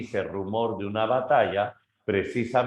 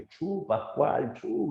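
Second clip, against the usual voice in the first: higher, slow mock shoo; shooing shout; 6 semitones higher, much slower than usual. Grandfather shoos the pig away, slow shout.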